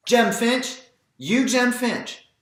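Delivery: high, loud call across the yard; old neighbour calling a boy's name. Acted. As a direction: hail a boy from far off, drawn out slowly. Miss Maudie calling across yards.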